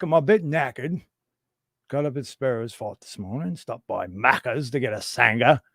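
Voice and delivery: hammed-up Aussie slang act; terrible Aussie accent. Streamer mimicking an Aussie for the chat, terrible Aussie accent. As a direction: put on a bad Australian accent. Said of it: "Terrible Aussie accent".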